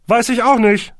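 high, loud blare; trumpeting. A narrator's reading. Horsti answering from the next room, trumpeting. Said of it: "trompetete Horsti".

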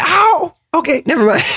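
high acted yelp of pain; high-pitched stung "ow". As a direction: yelp as if stung, then back off. Character stung by the scorpion.

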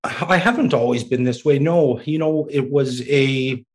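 ordinary talk, nothing marked

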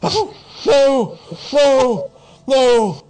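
loud, high, frantic doomsayer; distressed protest. Acted. Doomsayer told he is nuts, protesting frantically. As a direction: shout it down, desperate to be believed.

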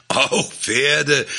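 high, emphatic, falling declaration; triumphant, certain reply; proud conviction. A narrator's reading. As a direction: announce it with chest puffed out. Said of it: "sagte stolze Brust und der Überzeugung".

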